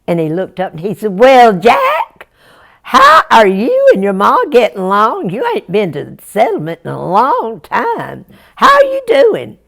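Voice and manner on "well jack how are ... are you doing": lightly voiced hearty shopkeeper, louder; friendly storekeeper. Storekeeper greeting young Jack, hearty and friendly. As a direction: greet him warmly, like an old neighbour.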